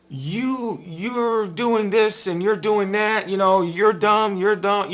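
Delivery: hammed-up insulting debater; mock insulting rant. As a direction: act out a petty fight. Acting out a debate fight.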